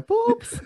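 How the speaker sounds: very high squeaky pop; a light bulb clicking on in his head; mock light-bulb pop. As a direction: make a silly pop sound. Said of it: "se me despertó el bombillo, como que".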